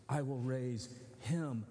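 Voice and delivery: slow, leaning hard on "him"; stress on him. Shown as a demonstration. Preacher stressing that Jesus raises one person, slow weight on "him". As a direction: dwell on "him" to show it is singular.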